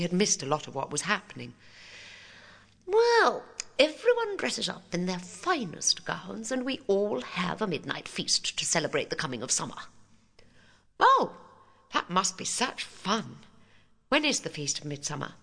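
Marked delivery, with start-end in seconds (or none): 2.8-9.8 a hint of a prim teacher; prim schoolmarm. Headmistress explains the feast. 11.0-15.3 put-on high, delighted girlish voice; eager little girl.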